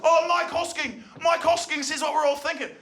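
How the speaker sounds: high mock admiration; mock talkback fan. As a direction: parrot it like a smug talkback listener.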